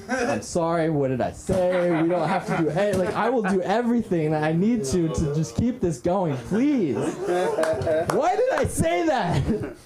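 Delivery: mock-frantic apology with stretched last words; desperate pleading. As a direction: plead comically, ending in a long high wail. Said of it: "And I'm desperate, so I'm like".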